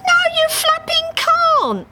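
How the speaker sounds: very high indignant squawk; protesting. Acted. Harry refusing to let Dave quit, high indignant protest. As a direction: protest hotly, flapping mad. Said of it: "she protested".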